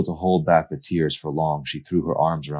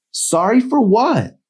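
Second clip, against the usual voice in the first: put-on high, louder young woman voice; higher, irritated girl voice; Emery's voice; 5 dB louder, slower than usual. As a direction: voice a fed-up girl calling him back. Emery calling after nurse.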